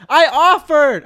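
loud high indignant repeat; loud protest. Real, not acted.

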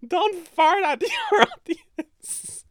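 don't fart at your audience squeezed out high through laughter; slow laughing squeal. Real, not acted. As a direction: crack up on it. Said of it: "Rule number one".

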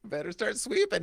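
high, screechy witch line. Retold or imitated. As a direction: cackle it out like the witch. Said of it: "actual line".

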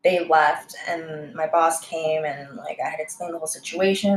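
ordinary delivery

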